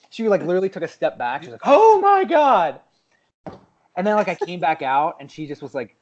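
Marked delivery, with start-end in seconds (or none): 1.6-2.8 high, loud, very drawn-out outrage; mock offended gasp. Retelling her stepping back, mock offended. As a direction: imitate a scandalized woman, stretched right out. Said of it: "she was so offended".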